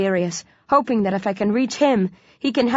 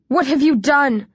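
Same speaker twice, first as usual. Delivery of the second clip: horrified cry; 6 semitones higher than usual. A narrator's reading. Hero horrified at the killing.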